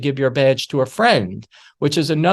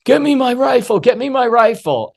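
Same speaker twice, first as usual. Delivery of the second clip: desperate plea; 9 semitones higher than usual. Retold or imitated. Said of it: "And he's like".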